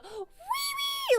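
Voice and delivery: very high, drawn-out squealed shout; super loud call. Retold or imitated. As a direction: shriek a name down the escalator. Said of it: "like, super loud".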